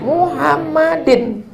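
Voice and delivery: high, drawn-out Muhammadin ending in -in; slow teacher's demo of Muhammadin; Arabic case ending. Shown as a demonstration. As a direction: stretch Muhammadin slowly, sounding the -in ending.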